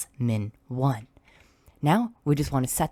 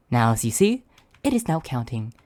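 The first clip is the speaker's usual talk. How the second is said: normal talking voice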